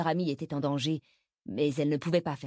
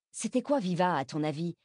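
ordinary delivery

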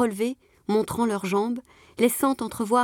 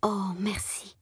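soft, breathy whisper, much quieter; murmured thanks; 6 dB quieter, slower than usual. A narrator's reading. The rescued girl thanking her protector, in a whisper. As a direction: breathe a grateful thank-you. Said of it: "Elle murmura".

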